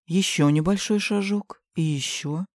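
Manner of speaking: ordinary delivery, nothing marked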